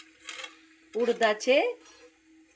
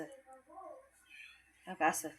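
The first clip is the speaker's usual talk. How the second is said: much softer, very slow, stretched out; soft drawn-out repeat; 3 semitones higher, 14 dB quieter, much slower than usual. Shown as a demonstration. Repeating for viewers, soft and drawn out. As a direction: let it trail off softly and slowly.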